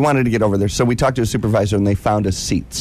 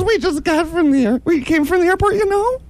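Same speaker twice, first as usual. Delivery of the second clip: high camp protest. Retold or imitated. Arguing with rental clerk.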